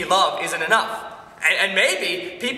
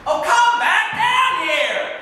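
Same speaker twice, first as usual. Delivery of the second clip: high, loud, drawn-out call; yelling; 11 semitones higher, 5 dB louder, much slower than usual. Acted. Husband yelling after his departing wife, exasperated shout. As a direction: bellow for them to come back. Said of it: "Stop yelling at me!".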